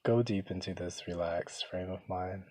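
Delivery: soft, hushed meditation voice with long pauses; guided meditation. Real, not acted. Begins leading the guided meditation.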